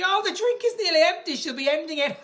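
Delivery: lightly voiced high mock viewer. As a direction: imitate a fan noticing the empty glass. Voicing viewers' thoughts.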